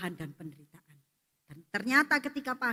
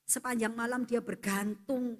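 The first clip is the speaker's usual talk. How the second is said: ordinary delivery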